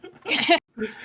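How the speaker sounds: high, loud burst of laughter; sudden real laugh. Real, not acted. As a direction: crack up out loud. GM amused by the player's plan.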